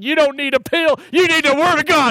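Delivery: put-on high, loud Texas-accented rebuke; talking Texan back. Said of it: "you start talking like that".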